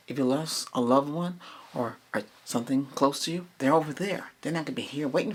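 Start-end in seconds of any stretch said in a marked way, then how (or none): none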